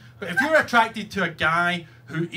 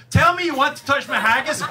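louder, higher mock command; insistent demand; 7 semitones higher, 4 dB louder than usual. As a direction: imitate her demanding it forcefully. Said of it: "she did it again".

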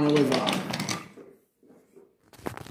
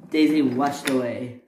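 plain reading voice

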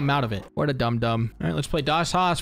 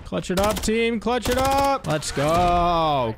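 hype call; 5 semitones higher, much slower than usual. Real, not acted. Rallying the team mid-match.

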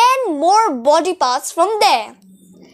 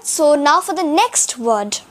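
ordinary delivery

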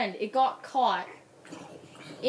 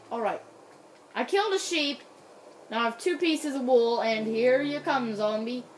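normal talking voice

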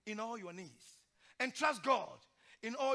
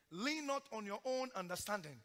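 normal talking voice

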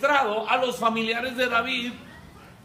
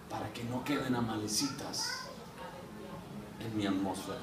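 low intense; 12 semitones lower, 11 dB quieter than usual. Acted. David's ending line, dropping.

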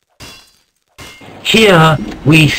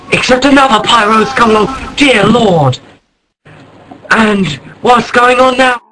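surprised; 9 semitones higher than usual. Acted. Narrator startled by new Pyro.

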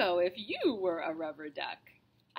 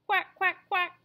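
high, loud little quacks; duckling quacks; 10 semitones higher, 6 dB louder than usual. Acted. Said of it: "say the ducklings".

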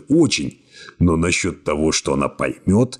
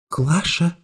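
quiet call; 12 semitones higher than usual. A narrator's reading. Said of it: "тихо позвала".

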